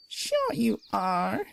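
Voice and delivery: put-on slow, high knowing old lady; teasing old mare; knowing wink. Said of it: "she said with a wink".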